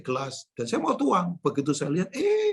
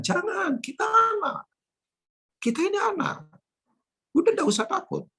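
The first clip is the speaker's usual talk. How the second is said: put-on high, emphatic cry that we are children; excited exclaim. Preacher lifting the congregation up, high and excited. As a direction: lift the voice right up in joy.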